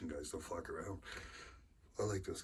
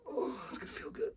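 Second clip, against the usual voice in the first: strained effort. Real, not acted. Straining through the lift.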